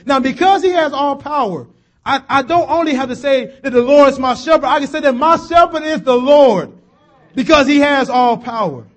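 loud, high, emphatic sermon shout; preaching at full force. Acted. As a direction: drive the point home to the church.

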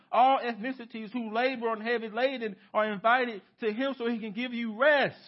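rising pulpit fervor; full-force preaching. Acted. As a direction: proclaim it to the whole room.

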